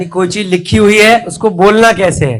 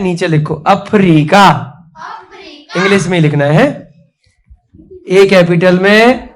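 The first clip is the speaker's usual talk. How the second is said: usual speaking voice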